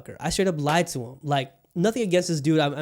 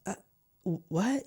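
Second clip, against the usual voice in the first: imitating someone slightly confused; lightly acted puzzled reply; mild confusion; 4 semitones higher, 5 dB quieter than usual. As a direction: stammer a higher, softer questioning what. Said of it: "He got confused a little bit".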